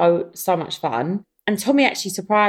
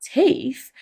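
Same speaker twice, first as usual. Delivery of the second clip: surprised question; 8 semitones higher than usual. Retold or imitated. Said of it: "And I was like".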